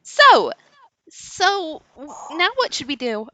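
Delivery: put-on tiny little girl voice, high pitched.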